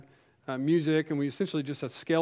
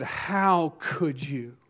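retold angry accusation; much slower than usual. Women storm down aisle at preacher.

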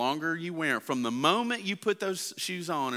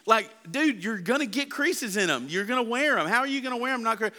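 higher, baffled disbelief; can't believe what he hears; dad scoffing at his son's creases, higher and incredulous; 8 semitones higher than usual. Retold or imitated. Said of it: "I'm like".

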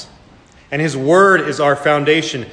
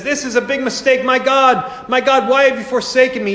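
much higher, lightly hammed-up voice of a bewildered man; mock confused Jesus; 8 semitones higher than usual. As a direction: play a panicked Jesus the way sceptics picture him. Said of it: "they said".

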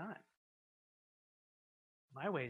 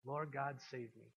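ordinary talk, nothing marked

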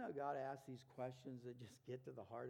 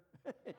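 short laugh; 8 semitones higher than usual. Real, not acted. Laughs at own skit.

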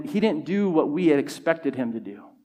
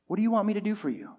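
quicker, softer, higher asking; gentle question; 4 semitones higher, 6 dB quieter, much faster than usual. Acted. Jesus asking the blind man, soft and quick. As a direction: ask it lightly and softly, in one run. Same question repeated more lightly.

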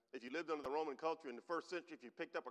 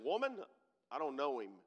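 emphatic denial; 2 semitones higher, 10 dB louder than usual. Acted. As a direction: deny it hard, raised and loud. Peter denies Jesus in retold scene.

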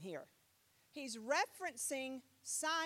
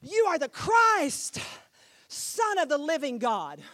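loud proclamation; 3 semitones higher, 13 dB louder than usual. Acted. Simon's confession proclaimed.

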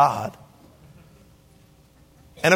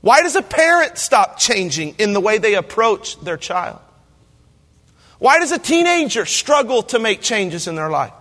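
normal talking voice

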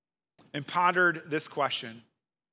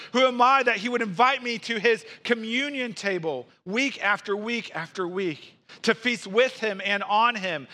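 building preacher's litany, higher and pushing; preaching; 5 semitones higher than usual. Acted. Pastor to congregation, mounting rhetorical questions, fervent preaching. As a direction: pile up each question with rising fervor.

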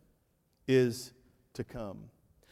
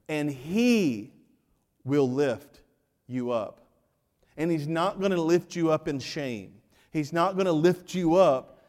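ordinary delivery

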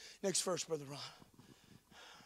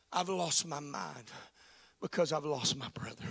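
hushed grief; 6 semitones lower, 7 dB quieter than usual. Acted. Mary to Jesus about her brother, hushed and grieving. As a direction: let it fall apart quietly. Mary's broken words, hushed.

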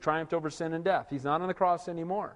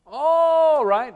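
sarcastic all right; 9 semitones higher, 7 dB louder than usual. Acted. Sarcastic aside.

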